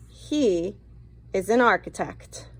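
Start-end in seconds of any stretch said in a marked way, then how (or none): none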